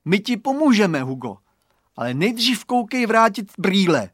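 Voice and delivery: stern demand. A narrator's reading. Boy demanding glasses before helping, stern. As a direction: bargain firmly at high volume. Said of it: "vyzval ho přísně Radovan".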